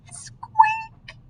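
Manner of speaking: high breathy whispered squeak; a tiny squeak on the breath. Acted. The noise Owl hears in bed, whispered high squeak.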